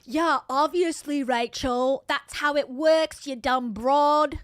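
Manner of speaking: loud, slow, scornful put-on outburst; mock annoyed husband. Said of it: "This is what I think he thinks".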